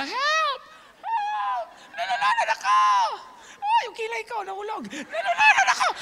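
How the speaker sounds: high, loud hammed-up screams with giggles; overacting drama queen. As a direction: camp it up like a diva drowning. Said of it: "magpapakaarte ka". (OA na sigaw, parang nagpapaka-arte)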